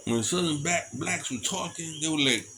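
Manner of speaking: normal talking voice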